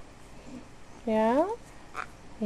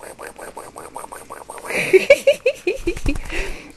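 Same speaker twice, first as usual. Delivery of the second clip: silent wheezy laugh; 11 semitones higher than usual. Real, not acted. Laughing at the bird.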